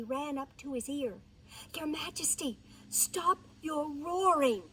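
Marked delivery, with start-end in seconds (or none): 1.7-4.6 slightly high, insistent mouse voice; lightly voiced warning mouse; urgent mouse. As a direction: urge the king to stop, word by word. Mouse urgently hushes the lion.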